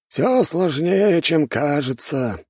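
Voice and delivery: high, slow elder voice; knowing old master. A narrator's reading. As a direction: draw it out high and ponderous.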